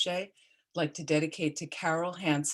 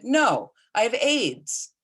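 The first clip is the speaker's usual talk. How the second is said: a slow, loud, higher joking retort; deadpan joke; 8 semitones higher, 6 dB louder, much slower than usual. Retold or imitated. Dying friend jokes back from the bathroom, slow and loud. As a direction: land the punchline slow and loud. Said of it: "he always had a great sense of humor".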